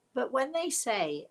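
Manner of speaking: normal talking voice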